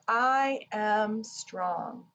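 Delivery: usual speaking voice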